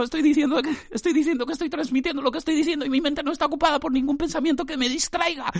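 very high, relentless run-on voice; frantic mock insistence. Podcaster swearing no other thought fills his mind, mock frantic.

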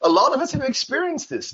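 normal talking voice

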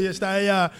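normal talking voice

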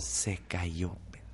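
hushed whisper. Retold or imitated. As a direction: breathe it out in shock. Tense moment in story.

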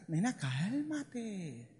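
gentle, drawn-out reassurance; soothing. Acted. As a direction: draw it out to calm her. Soothing an anxious girl.

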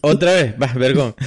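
normal talking voice

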